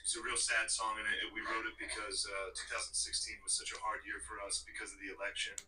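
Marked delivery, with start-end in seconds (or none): none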